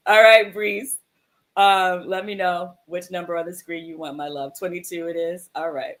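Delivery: ordinary delivery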